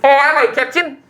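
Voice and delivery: high, eager hello; greeting shopkeeper. Acted. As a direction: greet the newcomer in a high put-on voice.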